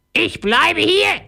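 slow, very high parrot squawk. A narrator's reading. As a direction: screech it out as a determined parrot. Parrot announces his decision. (hohe, gedehnte Papageienstimme)

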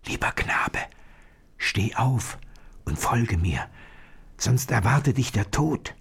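hushed, urgent warning whisper; secretive half-whisper. A narrator's reading. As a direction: whisper it carefully to wake someone. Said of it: "flüsterte ihm zu".

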